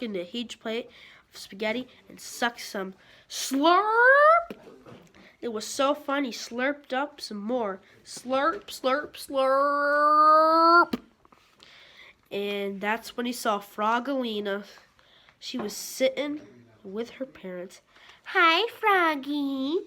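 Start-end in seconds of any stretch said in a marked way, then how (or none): 3.3-4.6 high, loud drawn-out slurp; long slurp. A narrator's reading. Slurping spaghetti. 8.0-11.0 slurps building louder; slurping. A narrator's reading. Slurping more. 18.2-19.8 slow, high, louder sweet call. Acted. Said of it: "called Frogalina".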